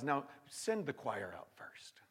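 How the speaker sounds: slow hushed whisper; whispered voice of God. Acted. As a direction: whisper the command. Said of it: "God says".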